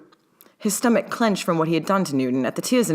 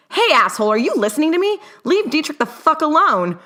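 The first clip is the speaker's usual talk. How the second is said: high furious shriek, much louder; 12 semitones higher, 5 dB louder than usual. A narrator's reading. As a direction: shriek at him in outrage. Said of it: "Newt shrieked".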